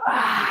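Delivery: forceful lion's breath exhale. Shown as a demonstration. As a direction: push the air out hard with a big ah. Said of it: "That's actually lion's breath".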